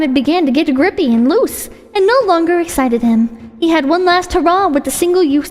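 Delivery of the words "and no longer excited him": ordinary delivery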